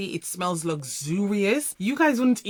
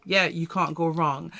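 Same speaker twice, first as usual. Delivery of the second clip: ordinary delivery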